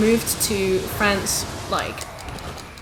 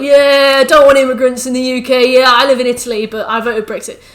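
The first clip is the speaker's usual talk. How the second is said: mocking Brexit voter; 3 semitones higher, 9 dB louder than usual.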